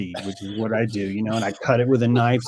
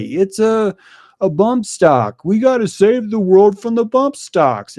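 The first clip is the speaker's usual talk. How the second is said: mock politician; 8 semitones higher, 7 dB louder, slower than usual. Mocking politicians' talking points.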